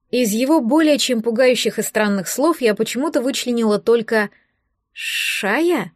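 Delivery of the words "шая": drawn-out, doubtful echo of the name; incredulous query. A narrator's reading. Girl asking about an unknown name, incredulous. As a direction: question the name in mild disbelief. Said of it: "недоверчиво переспросила я".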